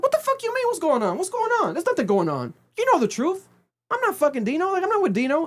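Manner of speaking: high, shrill-edged mock outrage, pitch spiking way up; imitating a furious denial, voice climbing high; heated outburst. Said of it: "Liz got heated".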